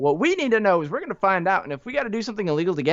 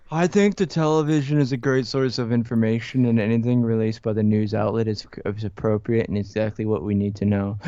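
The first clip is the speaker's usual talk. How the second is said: brainwashed viewer voice; 9 semitones lower than usual. Pretend. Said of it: "Brainwashing started".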